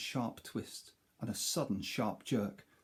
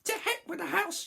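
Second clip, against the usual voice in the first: put-on very high scoffing old woman; defiant crone shout. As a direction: toss it off like a wild granny. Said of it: "she shouted".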